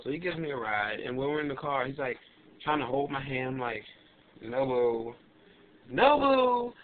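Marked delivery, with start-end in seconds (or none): 4.4-6.7 rising, louder "No, boo"; mock refusal. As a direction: brush him off, getting louder. Refusing his hand in car.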